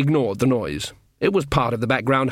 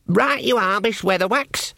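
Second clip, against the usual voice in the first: much higher, earnest lad's voice; eager young guard; 8 semitones higher than usual. Acted. Young guard greets his mum below, high and eager. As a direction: play the keen, simple boy soldier. [name] obeys.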